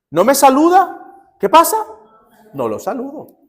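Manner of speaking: high, indignant, then drop flat; indignant mock outrage. Preacher imitating a petty neighbour, huffy indignation. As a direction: play the offended neighbour, huffy.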